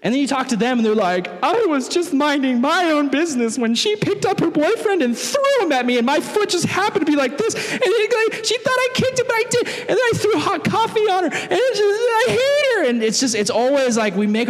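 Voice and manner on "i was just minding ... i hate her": high whiny voice building up to a mock sob; whiny victim rant. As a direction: spiral from whining into fake tears. Other side's whiny version.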